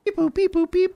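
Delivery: very high robotic beeping; mock machine beeps. Dwemer greeting the fleeing snow elves, high mock-machine voice.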